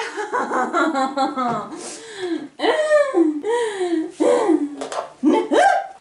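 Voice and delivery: high, loud laugh breaking into a squeal; real laughing shriek. Real, not acted. Owner laughing as her dog jumps on her, a high squeal.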